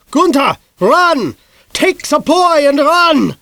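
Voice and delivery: high loud slow shout; wife shouting warning to husband. A narrator's reading. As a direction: scream at him to flee. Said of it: "things Missus Lysander shouted".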